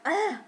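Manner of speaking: loud annoyed groan; frustrated ugh. Real, not acted. Annoyed at yawning.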